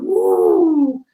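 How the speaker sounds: playful whoop for a viewer's energy; mock whoop of energy. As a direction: voice the rush of energy with a bright whoop. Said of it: "I can feel your energy going".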